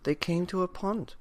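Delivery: ordinary delivery, nothing marked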